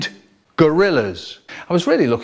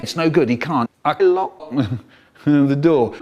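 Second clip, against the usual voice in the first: ordinary delivery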